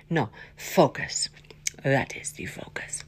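whispered self-reminder; whispering. Real, not acted. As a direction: whisper it to yourself.

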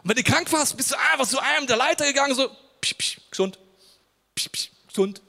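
high, loud hammed-up magic healing noises, psch psch; mock healing prayer. Preacher mocking his naive picture of the early church, hammed up. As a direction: send out quick zaps of pretend healing power.

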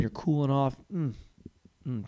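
ordinary delivery

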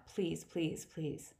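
a fading plea under the breath; pleading, fading. A narrator's reading. Mother pleading, fading away.

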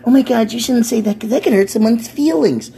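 high, prissy mocking voice of an oversensitive critic; mocking sensitive person. Comic mocking an oversensitive critic in a high prissy voice. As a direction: whine it out like a pearl-clutching scold.

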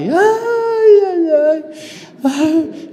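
high wailing sobs; mock crying. Homesick seminarian boy leaving his parents, mock crying.

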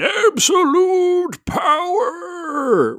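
very high, drawn-out villain cry; the Emperor. Retold or imitated. Quoting the Emperor's line, shrieked slowly and high. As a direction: stretch it into a shrieking evil Emperor wail. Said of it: "the guy known as the Emperor".

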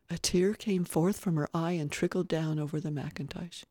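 usual speaking voice

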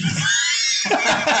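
a high shrieking burst of laughter. Real, not acted. Howling with laughter at the joke. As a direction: let out a piercing high cackle.

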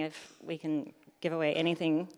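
ordinary talk, nothing marked

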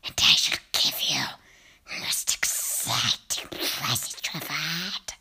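very slow, low, whispered coaxing; rasping witch whisper. Acted. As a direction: lure the child down with a creepy hiss. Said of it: "Her voice had a curious rasping quality".

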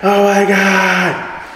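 high, drawn-out mock wail; freaking out. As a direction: imitate a woman in hysterics, stretching the words. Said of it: "she starts freaking out".

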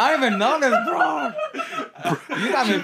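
cracking up while protesting to friends; laughing disbelief. Real, not acted.

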